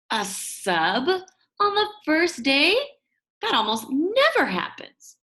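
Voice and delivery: surprised disbelief. Real, not acted. Narrator's disbelief at substitute.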